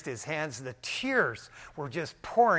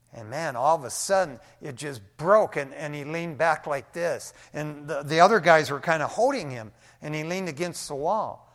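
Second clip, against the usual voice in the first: ordinary talk, nothing marked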